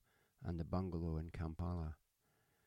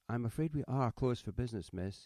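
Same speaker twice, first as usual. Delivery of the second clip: polite refusal; 5 semitones higher, 6 dB louder than usual. A narrator's reading. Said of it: "he said behind the door".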